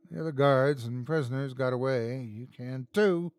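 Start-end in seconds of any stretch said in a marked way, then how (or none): none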